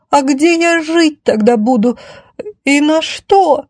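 high, horrified damsel voice; high-pitched horror, drawing out the end. Acted. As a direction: wail it in dismay. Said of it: "Ужас в голосе осаждённой".

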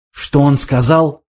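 usual speaking voice

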